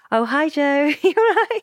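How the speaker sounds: high bright greeting with a laugh; imitating sweet shop girls. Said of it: "the girls are so sweet. They're like".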